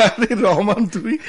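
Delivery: put-on loud, high laughing greeting of an old friend; happy shout on spotting a college friend; delighted surprise. Meets old college friend suddenly.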